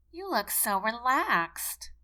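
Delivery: slow, nasal secretary voice; nasally voice. Acted. Secretary Miss Plush remarks to the nervous private, nasally. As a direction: drag it out through the nose. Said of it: "in her nasally voice".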